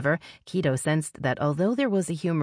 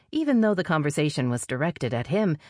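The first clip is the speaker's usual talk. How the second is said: plain reading voice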